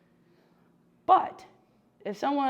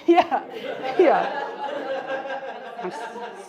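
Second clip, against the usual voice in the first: talking through real laughter; laughing; 4 semitones higher, 6 dB louder, much slower than usual. Real, not acted. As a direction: laugh as you agree. Laughing with the audience.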